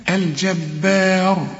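الجبار light until a dark RU; only ra heavy. Shown as a demonstration. Teacher models it, light الجبار with heavy ر. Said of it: "الراء بس هي المفخمة".

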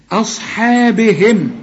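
أصحابهم slowly, with a thin long "hae"; light H and madd. Shown as a demonstration. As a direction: spell out أصحابهم syllable by syllable, H kept light. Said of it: "الحاء مرققة مع المد بتاعها".

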